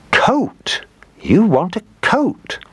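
high, swooping exclamation of surprise; astonished guess. A narrator's reading. Old woman guesses her dog's wish, high and astonished. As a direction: hit on the answer in high amazement.

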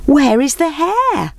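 high, drawn-out call. A narrator's reading. Animals to the approaching tortoise, calling out. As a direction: call the question across a clearing. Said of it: "they called out".

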